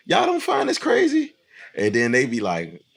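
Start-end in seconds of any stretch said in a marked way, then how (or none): 0.1-1.3 mock disbelief. Acting out his question to students.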